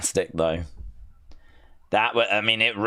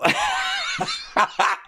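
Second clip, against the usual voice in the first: squeaky high giggle. Real, not acted. Amused by the innuendo, high giggle. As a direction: break into a giggle. Laughing at trowel line.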